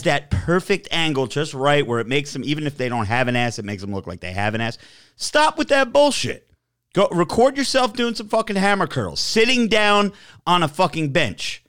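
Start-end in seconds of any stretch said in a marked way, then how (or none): 5.1-11.6 high-pitched, exasperated telling-off; scolding rant. Acted. Ranting at gym posers filming themselves, exasperated scolding. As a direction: lay into them with a raised pitch.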